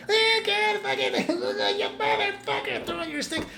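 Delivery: impersonating an outraged commentator losing it; James Chen rant. Retold or imitated. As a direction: launch into a squealing outraged rant. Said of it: "What is that James Chen thing?".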